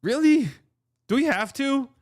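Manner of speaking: mock high whine; whiny reluctance. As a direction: squeak it out like a reluctant team. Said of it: "I could see just being like".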